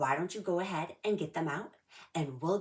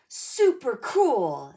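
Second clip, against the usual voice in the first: louder, higher, drawn-out thrilled cheer; excited cheer; 6 semitones higher, 11 dB louder, much slower than usual. Acted. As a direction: cheer it like the best thing ever. Callie cheers as lava erupts.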